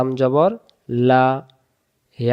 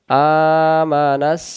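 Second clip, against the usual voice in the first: slow melodic recitation, nasal hum on the nun; slow Quran recitation; 2 semitones higher, much slower than usual. Shown as a demonstration. Teacher recites the word for students in a slow chant. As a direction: chant each sound out slowly with ghunna. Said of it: "না মিলবে সিনের সাথে".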